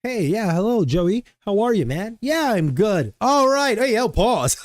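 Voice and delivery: high-pitched imitation of a chipper coworker; regular coworker. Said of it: "he's a regular, like, you would think".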